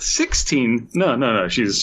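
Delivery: normal talking voice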